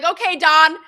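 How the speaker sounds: casual shout-back. Retold or imitated. Hockey player yelling to the president on speaker, chummy. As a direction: toss it back like he's his best friend. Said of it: "he's like".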